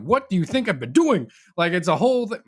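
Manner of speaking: high, loud exasperated retort; retold exasperation. Teen telling mom about the morning's contact struggle, exasperated. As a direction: throw it back as an indignant, acted-out protest.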